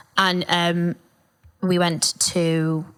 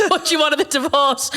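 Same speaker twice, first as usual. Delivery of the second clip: mock lawyer; 8 semitones higher than usual.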